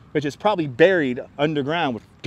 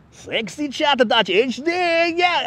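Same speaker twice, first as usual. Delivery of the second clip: put-on high, drawn-out Russian-accented ad read; mock plug in a Russian accent, rising high; 9 semitones higher, slower than usual. Streamer mocking a renamed spam account, Russian accent. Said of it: "Russian accent".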